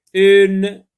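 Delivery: high, loud, clearly spoken dictation. Shown as a demonstration. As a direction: call the word out to the class. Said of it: "Deux syllabes".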